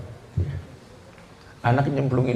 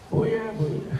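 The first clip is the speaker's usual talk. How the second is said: put-on toddler; 7 semitones higher, 8 dB quieter than usual. Toddler again, high.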